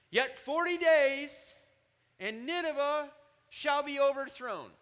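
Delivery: Jonah's harsh proclamation. Acted. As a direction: proclaim doom from the city gate, slow and loud. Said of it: "That's his propositional preaching".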